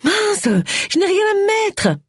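high, wailing complaint over her wardrobe; lamenting. A narrator's reading. Agatha complains to her friend, lamenting high. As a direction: whine it out like a dramatic lament. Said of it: "Elle commença par se lamenter".

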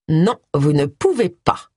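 slow "Non, vous ne pouvez pas" with each word separated; every word detached. Acted. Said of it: "en détachant bien chaque mot".

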